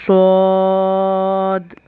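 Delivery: सौद said thick and very slow, held long; slow heavy सौद; heavy sad. Shown as a demonstration. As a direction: give सौद a full, heavy, drawn sound. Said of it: "मोटा पढ़ेंगे".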